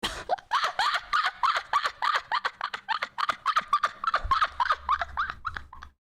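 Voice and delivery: very high squealing laugh; long, helpless high-pitched laughter; real laughing fit. Real, not acted. Streamer cracking up at the chat, real squealing laugh.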